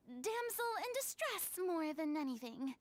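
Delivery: usual speaking voice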